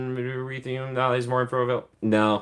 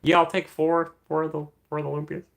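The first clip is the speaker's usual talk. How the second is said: high-pitched hesitant customer voice; mock customer, stalling on the ums; drive-thru customer; 6 semitones higher than usual. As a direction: place an odd order in a higher put-on voice. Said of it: "Pull up to McDonald's".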